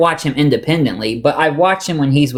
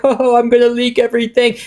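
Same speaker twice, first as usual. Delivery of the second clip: mock teary whine, higher and quick; imitating crying; 7 semitones higher, faster than usual. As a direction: lightly mimic a crying man threatening to leak. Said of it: "he was crying".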